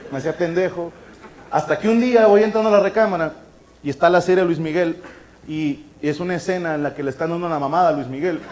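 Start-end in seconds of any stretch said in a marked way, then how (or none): none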